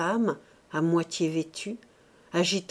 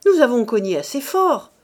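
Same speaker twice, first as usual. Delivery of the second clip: loud, raised rebuke; angry retort; 4 semitones higher, 9 dB louder than usual. A narrator's reading. The cook rebuking Mouret, angry retort. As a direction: throw it back at him loudly.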